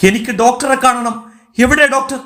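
high-pitched, insistent demand; acted frantic insistence; noisy demand. A narrator's reading. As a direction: demand the doctor in distress. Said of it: "ഒച്ചയുണ്ടാക്കരുത്".

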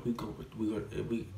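tripping over the words; real stutter on the repeated words. Real, not acted. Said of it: "damn, I'm stuttering".

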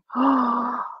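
high, quick gasp; hyperventilating gasp. Real, not acted. Seeing the stone paper, overwhelmed gasp. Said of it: "I'm hyperventilating over this paper".

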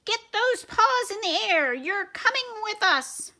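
squeaky mouse voice. Acted. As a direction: squeak it like a tiny commander. Mouse commander orders the dog.

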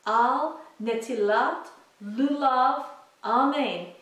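slow, careful Hebrew prayer recitation; slow Hebrew blessing. Shown as a demonstration. Teacher to kids practicing, slow Hebrew blessing. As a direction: spell out each Hebrew word slowly for kids to copy. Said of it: "I'm going to say it very slowly in Hebrew".

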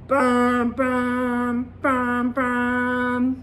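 bang bang, each hit; truck bangs. Retold or imitated. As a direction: pound out the truck noise. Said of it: "viene un gran camión". (golpes del camión)

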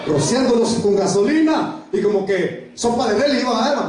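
ordinary delivery

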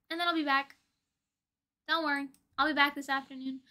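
ordinary talk

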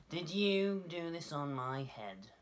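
high, slow, accusing mole voice; little mole asking. A narrator's reading. Mole questions the passing dove, slow high accusing voice. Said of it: "he asked the cow".

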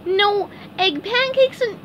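very high cartoon wail; SpongeBob crying. A narrator's reading. SpongeBob tells Gary the eggs are gone, mock crying. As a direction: lament it high, starting loud. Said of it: "SpongeBob went home and cried".

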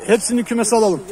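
calling out. Real, not acted. Keeper shouting to his helper to open the coop. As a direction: shout the request to someone far off.

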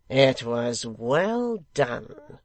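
loud, very slow praise; secretary, approving. A narrator's reading. Secretary praising the stone-thrower, approving. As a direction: praise it in long drawn words.

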